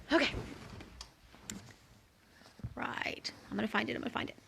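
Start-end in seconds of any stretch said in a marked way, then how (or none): 2.7-4.4 whispered aside while searching. Real, not acted. Reader loses her place live, whispering to herself. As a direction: hush it to yourself, finding your place. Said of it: "That's what happens when you do it live".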